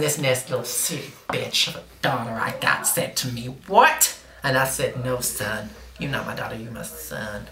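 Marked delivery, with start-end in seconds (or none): 3.7-4.1 sharp high "what", rising; incredulous retort. Retold or imitated. Daughter's quoted reply in story. 5.0-7.5 low, quiet put-down; low deadpan retort. Retold or imitated. Mother's quoted answer to daughter.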